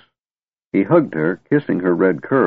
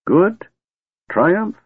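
much higher, disbelieving echo; incredulous repeat; 13 semitones higher than usual. Acted. Old wizard echoes Tanis in disbelief, much higher. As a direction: scoff at the very idea. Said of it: "Fizban repeated".

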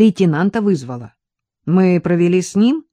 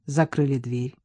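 ordinary delivery

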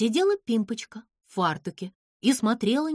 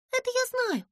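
high, clipped cutting-off line; curt retort; 13 semitones higher than usual. A narrator's reading. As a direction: cut him short. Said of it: "отрезала Пимпочка". (отрезать резко)

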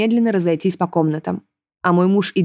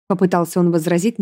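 ordinary delivery, nothing marked

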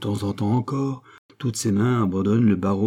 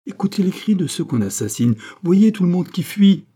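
high, urgent plea growing louder; urgent alarm; 9 semitones higher, 4 dB louder than usual. A narrator's reading. Gilbert warns the king of people fleeing, urgently alarmed. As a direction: press him urgently, building in pitch and volume.

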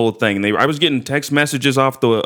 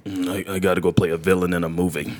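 much lower, much softer gravel-free mumble; very low, very soft Mickey Rourke impression; 8 semitones lower, 6 dB quieter than usual. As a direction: sink deep and quiet like a movie villain. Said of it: "sounds like my mom when she's off the air".

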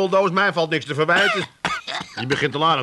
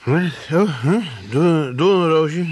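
groggy just woken; 4 dB louder, much slower than usual. Real, not acted.

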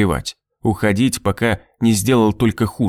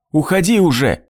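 high, urgent cry; shouted; 7 semitones higher than usual. A narrator's reading. Wife and son ordering father out, shouting. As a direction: drive him out with it. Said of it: "кричали они хором".

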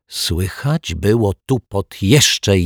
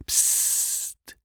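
very soft, voiceless shush; hushing someone under the breath; hushing psst; 12 dB quieter than usual. A narrator's reading. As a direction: hiss a quiet "psst". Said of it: "uciszył ją detektyw Pozytywka".

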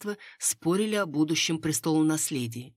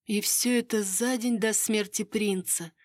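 slow, higher-pitched pondering; musing, drawn out; 6 semitones higher than usual. A narrator's reading. Miss links pregnancy to prince's death, musing. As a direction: draw it out thoughtfully. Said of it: "задумчиво протянула мисс Ферфакс".

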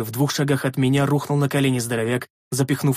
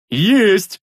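loud, higher burst of delight; joyful bark; 7 semitones higher, 6 dB louder than usual. A narrator's reading. Big man seeing magic work, joyful bark. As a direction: bark out in triumph. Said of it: "обрадованно рявкнул Рикар".

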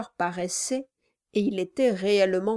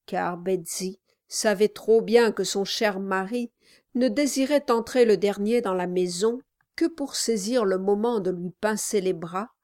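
ordinary talk

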